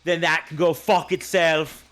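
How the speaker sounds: high, loud put-on settler rant; mock colonist rant. Acting a colonist with a musket.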